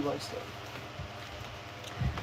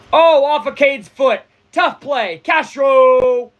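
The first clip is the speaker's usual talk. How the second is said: high, loud, sudden play-by-play yelp; startled fan calling the play; excited live call; 8 semitones higher, 7 dB louder, much slower than usual. Real, not acted. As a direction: react as the ball hits the foot. Live reaction to a close play.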